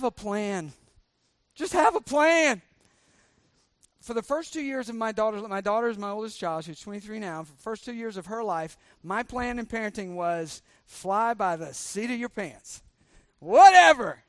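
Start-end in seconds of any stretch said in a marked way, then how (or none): 1.5-2.6 louder, higher and slow, every word stressed; emphatic plea, slow. Real, not acted. Preacher stresses key advice. 13.4-14.2 loud, high, careless shrug of a word; loud careless whatever; mock careless. Mocking his old careless plan.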